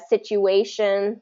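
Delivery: "situation" slowly, stress on A before "tion"; slow demo, "situation" as situ-A-tion; stress before the ending. Shown as a demonstration. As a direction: lean on the A of "situation". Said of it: "stress the syllable right before that ending".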